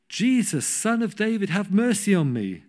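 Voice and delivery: high, loud desperate call; crying out. Retold or imitated. Blind beggar calls to passing Jesus, high loud cry. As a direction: reach across the crowd with the plea.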